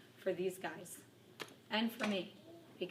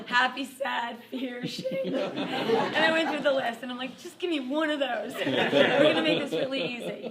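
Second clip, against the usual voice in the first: louder, higher, laughing through the list; reading the feelings list to her son, laughing hard; laughing while retelling; 6 semitones higher, 12 dB louder than usual. Real, not acted. Laughing out loud through each word.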